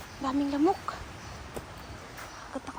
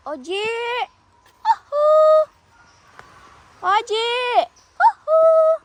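high, loud hooting call; 9 semitones higher, 7 dB louder, slower than usual. Real, not acted. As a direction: hoot out to carry far. Calling out for lost cat.

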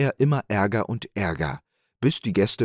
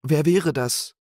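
hoch und etwas lauter, hastig gefragt; hoch, aufgeschreckt nachfragen; aufgeregte Frage des Wirts; 8 semitones higher, 4 dB louder than usual. A narrator's reading. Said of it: "fragte der Wirt". (Wirt fragt aufgeschreckt nach)

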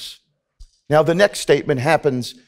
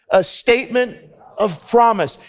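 call to repeat; 8 semitones higher than usual. Shown as a demonstration. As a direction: say it with them, big and ringing. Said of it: "Can you say that with me".

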